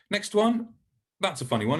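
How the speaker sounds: ordinary talk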